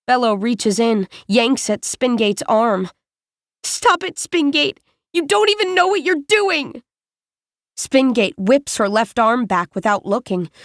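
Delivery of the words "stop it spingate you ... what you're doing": higher, upset scolding; angry outburst. A narrator's reading. Bello grabbing Spingate's arm, scolding her higher. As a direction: tell her off sharply.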